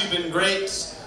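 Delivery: usual speaking voice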